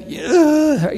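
a sharp high "ugh" of disgust; disgusted groan. Retold or imitated. Victim of a burglary reacting, high disgusted groan. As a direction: groan like your own things were just wrecked. Reaction to home being robbed.

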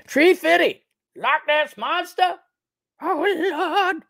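high, squawked comic character voice; cartoonish high-pitched outburst; comic catchphrase impression. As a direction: exclaim it way up high, hammed-up. Acts out Loch Ness Monster catchphrase sketch.

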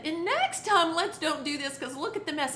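mother gently guiding daughter; gentle correction. Retold or imitated. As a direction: coax the child to do better. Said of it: "And then I said".

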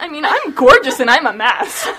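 laughing boast. Real, not acted. Friend arguing about competence, loud laughing self-joke. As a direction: argue the point while cracking up. Joking argument about a character.

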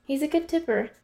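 put-on waitress's Russian accent for a tipper. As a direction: lift it up in a fake Russian accent. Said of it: "Again with the accent?".

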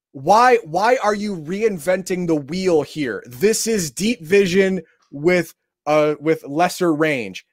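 slow, deliberate, incredulous; slow exasperation. Real, not acted. Player spelling out the renamed rule, slow and exasperated. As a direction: spell it out slowly in disbelief. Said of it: "I'm pissed off".

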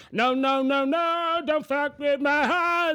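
high, loud and slow hammed-up plea; mock pleading cry. Tripping narrator begging the scene, mock pleading. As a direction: wail it like a melodramatic song line. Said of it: "Så det är så här".

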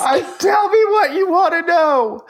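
high, loud hammed-up yell; mock shout. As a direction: squeal it out in a mock panic. Mock shout mimicking Neff.

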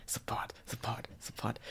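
breathy whispered plea. Real, not acted. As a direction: whisper support, support. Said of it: "whispered plug".

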